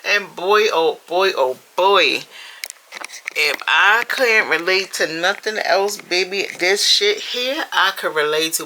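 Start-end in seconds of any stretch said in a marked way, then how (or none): none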